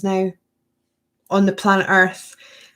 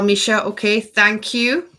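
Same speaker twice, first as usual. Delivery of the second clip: normal talking voice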